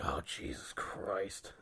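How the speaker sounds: slow breathless whisper of disbelief; exasperated whisper. Retold or imitated. Aside to himself while the stranger raves, slow exasperated whisper. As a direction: mutter it under your breath, slow.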